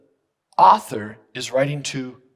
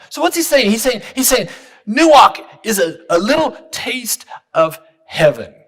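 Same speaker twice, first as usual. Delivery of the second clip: much higher and louder preaching; fervent preaching; 10 semitones higher, 8 dB louder than usual. Acted. Pastor exclaiming to the church that nuach is heaven. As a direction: soar into the taste of heaven.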